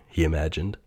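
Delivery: ordinary delivery, nothing marked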